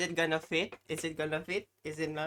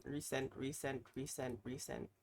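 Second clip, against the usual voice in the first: muttering softly; 2 semitones lower, 8 dB quieter than usual. Real, not acted. Muttering while searching.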